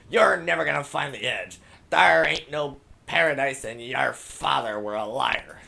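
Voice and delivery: loud, high pirate villain yelling. Acted. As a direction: bellow it at the rival captain. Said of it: "He yelled to Captain Allegra".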